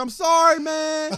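high, loud pleading shout; shouted high-pitched sorry; yelled apology. Retold or imitated. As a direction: call it out across the street begging. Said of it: "He yelling across the".

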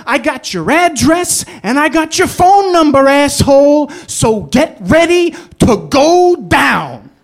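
garage owner threatening the driver over the phone, furious; furious mobster. Retold or imitated. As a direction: bark each threat at the cabbie. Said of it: "this is what he said".

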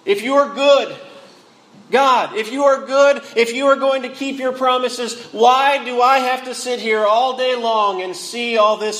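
high, insistent plea to God; challenging question. Acted. Preacher voices Habakkuk questioning God's goodness, insistent. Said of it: "Habakkuk is asking the question".

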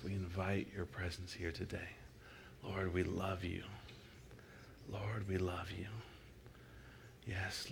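soft, hushed prayer to the Lord; soft worship prayer voice; quiet prayer. Shown as a demonstration. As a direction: pray it quietly, leading the room. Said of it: "you can say it in a quiet voice".

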